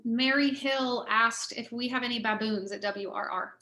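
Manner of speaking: usual speaking voice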